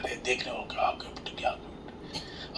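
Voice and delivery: hushed, voiceless marvelling; awed whisper. Acted. Onlookers admiring a wrapped birthday gift, acted whisper. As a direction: whisper it as if secretly admiring a present.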